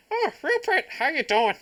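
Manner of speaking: high, loud mock Irish greeting; a failed Irish accent, squeaky and high; bad Irish accent. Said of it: "gotta throw the Irish accent in with it".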